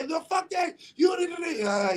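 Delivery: high-pitched mock outburst; angry cussing. Someone venting anger at a listener, high mock outburst. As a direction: act out a heated, high-pitched rant.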